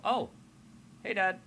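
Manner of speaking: Kara. Acted. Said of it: "see Aaron and go".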